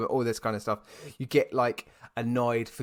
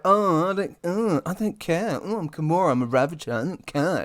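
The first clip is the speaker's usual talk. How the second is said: lightly voiced bratty Gamora, higher and mocking; 6 semitones higher, 4 dB louder than usual. Mocking Gamora sitting alone eating nuts, bratty. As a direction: sneer it like a sulky teen.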